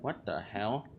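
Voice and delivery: ordinary delivery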